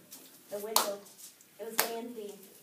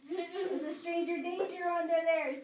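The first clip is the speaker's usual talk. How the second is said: warning punchline; 6 semitones higher, 6 dB louder than usual. Acted. Moral of scary tale.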